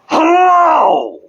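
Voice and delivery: a sudden, high, loud shouted hello; mock cheerful villain greeting. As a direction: burst back in with a yell. Menacing return greeting to news outlets.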